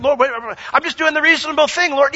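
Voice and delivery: high, fast protest; protesting believer. Retold or imitated.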